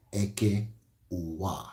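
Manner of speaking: normal talking voice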